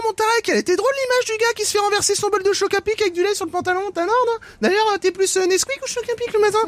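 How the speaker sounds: very high, bright breakfast-show patter; morning radio host voice. Pretend. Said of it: "vieux réflexe de matinale".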